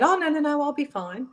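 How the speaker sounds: brushing it off. Retold or imitated. Retells brushing off her son's concern.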